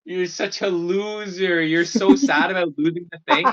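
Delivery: mock the loser like a teasing kid; lightly voiced friend jeering between laughs. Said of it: "friends just started laughing at me".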